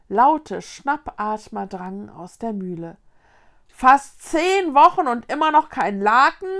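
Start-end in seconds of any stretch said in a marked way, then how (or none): 3.7-6.5 high, very loud outcry; calling out, shocked. A narrator's reading. Ghost grandpa hears of ten sheetless weeks, crying out. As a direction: exclaim it in loud disbelief. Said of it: "rief der Geisteroper".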